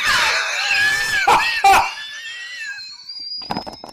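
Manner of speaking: very high, long shouted laugh; shrieking laugh. Real, not acted. Streamer shrieking with laughter after learning his computer was fine.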